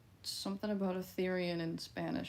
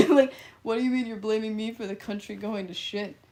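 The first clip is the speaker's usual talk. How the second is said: louder mock outrage, laughing into it; indignant retort; 8 dB louder than usual. Streamer retorting to chat blaming them, loud mock indignation. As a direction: fire back like you're blamed unfairly. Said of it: "I was like".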